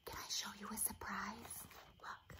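hushed whisper, leaning in to share a surprise; soft conspiratorial whisper; secret whisper. Real, not acted. Sharing a secret with child.

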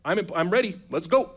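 gung-ho disciple itching to leave; eager Peter. Acted. Peter rallying Jesus to set out for Lazarus, eager. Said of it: "Peter's probably like".

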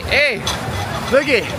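normal talking voice

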